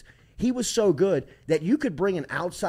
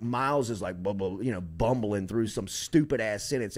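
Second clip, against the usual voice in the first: ordinary talk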